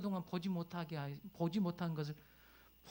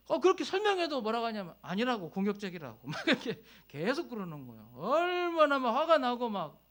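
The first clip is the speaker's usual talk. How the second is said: louder, higher, rising indignation; heated retelling; 8 semitones higher, 8 dB louder than usual. Real, not acted. Retelling anger at the accusation.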